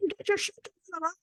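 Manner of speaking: high, strained mock yell held back in volume. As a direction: imitate a frazzled parent yelling, fast and squeezed. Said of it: "I was yelling at my kids".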